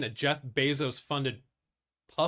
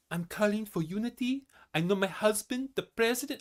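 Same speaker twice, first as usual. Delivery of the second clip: a brief, half-started imitation of Melania's accent, pitched higher; light, hesitant put-on of Melania's accent; 7 semitones higher than usual. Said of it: "I'm not gonna do the accent for her".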